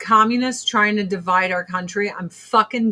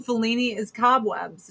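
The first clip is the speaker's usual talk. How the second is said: ordinary talk, nothing marked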